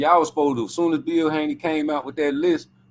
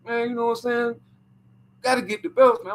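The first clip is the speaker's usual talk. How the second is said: lightly voiced pleading dad, a bit higher; mock Bill begging for belts; Bill Haney impression; 4 semitones higher than usual. Bill on the phone pushing for the belts, lightly imitated. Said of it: "He be like".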